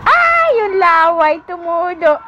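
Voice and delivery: playful exclamation. Real, not acted. Mother to her drooling baby, high playful exclamation.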